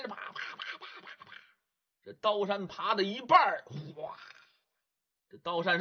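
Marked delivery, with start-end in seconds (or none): 0.1-1.3 whispered slapping pat-pat sounds; voiceless pats fading to nothing; climbing sound effect. Acted. Ghost climbing knife mountain.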